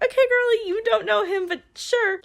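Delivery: high-pitched, sceptical playing along; mock humouring. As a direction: humour a deluded friend up in a high voice. Mock humouring a deluded friend.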